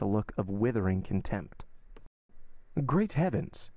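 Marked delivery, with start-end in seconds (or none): 2.9-3.7 high-pitched startled cry; sudden exclamation. A narrator's reading. Guest at the window spots the horses, sudden exclamation. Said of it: "suddenly exclaimed Debray".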